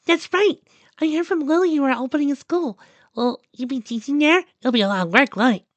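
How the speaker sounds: put-on high, squeaky girlish excitement; bubbly high-pitched character voice; Duck, excited. A narrator's reading. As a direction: burst out eagerly in a very high voice. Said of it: "her voice echoed the excitement".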